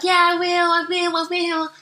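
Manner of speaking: high, put-on mum voice; mimicked fobbing off. Daughter imitates mum dodging exercise, high fobbing-off. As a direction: imitate mum stalling, pitched well up.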